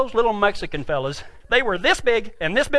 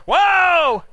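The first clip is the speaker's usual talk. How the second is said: higher, drawn-out holler; mock yell; 5 semitones higher, 3 dB louder than usual. Partner stopping the horse mid-run, mock yell. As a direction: imitate a cowboy halting his horse. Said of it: "and he yells".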